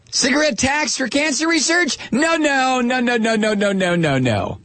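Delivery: high, mocking chant of no, no, no; mocking right-winger. Host mocking right-wingers refusing everything, in a high sing-song voice. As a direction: parody the right-wingers shaking their heads.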